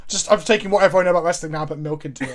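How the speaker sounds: ordinary delivery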